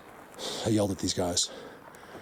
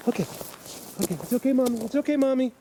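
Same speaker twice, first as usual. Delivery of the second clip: much higher, gentle coaxing voice; soothing mother deer; 12 semitones higher, faster than usual. Real, not acted. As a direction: reassure the doe sweetly and high.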